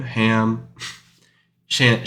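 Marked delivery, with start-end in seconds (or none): none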